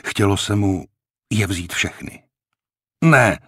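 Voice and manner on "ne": curt, angry refusal, higher and louder; angrily, with a burst of volume. A narrator's reading. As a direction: spit out a loud, raised no. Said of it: "řekl stekle".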